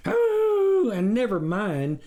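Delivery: loud high "Oh" with relish; stranger enjoying. A narrator's reading. The wolf relishing the wife's support. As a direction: savour it. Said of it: "the stranger enjoyed".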